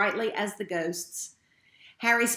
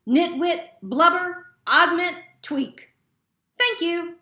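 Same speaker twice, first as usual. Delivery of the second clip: higher, louder, delighted nonsense; gleeful odd words; 9 semitones higher, 6 dB louder than usual. A narrator's reading. Dumbledore giving his strange speech, gleeful and loud. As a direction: announce each word like a triumph.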